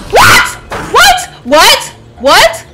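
very high, loud frightened shrieks; panicked what. Real, not acted. Panic after spider attack.

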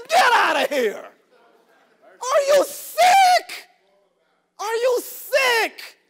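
high, screamed rebuke; full-throated pulpit outburst; outraged shout. Real, not acted. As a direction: yell it in disbelief, dragging out the words. Outraged preacher rebuking hypocrisy.

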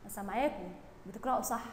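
ordinary delivery

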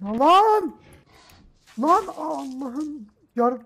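loud, high scream of real shock; jump-scare yell, voice shooting up; frightened scream. Real, not acted. Player hit by a sudden monster scare, screaming.

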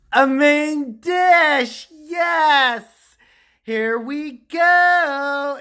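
high, loud, drawn-out shout; hammed-up excited announcement. Cooking host unveiling the main dish, loud and overexcited. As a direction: stretch it out like a grand unveiling.

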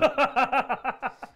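loud, high-pitched laughing fit; real high, rapid cackling laugh; burst of laughter. Real, not acted. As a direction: laugh helplessly at a friend's mishap. Laughs at the mishap.

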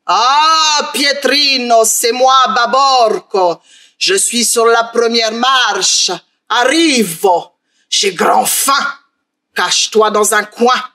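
ogre creeping up the stairs, slow and drawn out; slow menacing ogre. Acted. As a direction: drag each word, sinister and patient.

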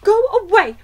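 loud, high, cross wife; irritated dismissal. A narrator's reading. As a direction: shoo her off sharply.

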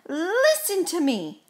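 high-pitched, commanding shout. A narrator's reading. Gus lays down the law to Mouse, shouting. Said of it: "shouted Gus".

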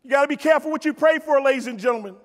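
usual speaking voice

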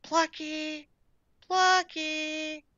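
soft, high, held-out cat call; calling softly. A narrator's reading. Gertrude not wanting to bother Daisy and Stan, calling softly. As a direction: sing the name out high but hushed. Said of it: "calling softly".

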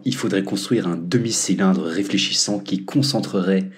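normal talking voice

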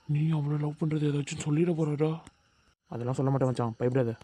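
low, softly spoken secret exchange; hushed aside. Acted. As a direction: keep it under your breath so the teacher misses it. Secret aside in front of the teacher. (ரகசியமாக அடங்கிய தாழ்ந்த குரல்)

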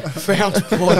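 laughing repeat. Real, not acted.

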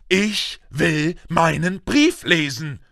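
slow, high insistent demand; calling out. A narrator's reading. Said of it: "rief er".

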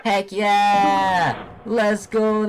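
high, drawn-out victory cheer; triumphant cheer. Real, not acted. Gamer finishing her fastest route yet, triumphant cheer. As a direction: celebrate a personal record, stretching the first cheer.

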